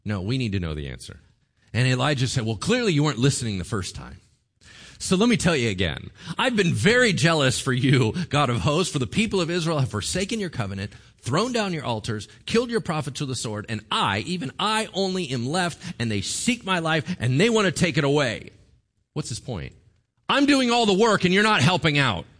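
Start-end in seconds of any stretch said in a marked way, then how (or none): 2.5-18.5 louder, exasperated whine; exasperated complaint. Acted. Elijah telling God again, loud exasperated complaint. 20.3-22.3 high, loud, bitter whine. Acted. Elijah accusing God, high loud bitter whine.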